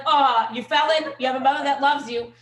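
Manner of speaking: put-on loud, high cooing to a child; doting mother. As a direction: gush over the child you love.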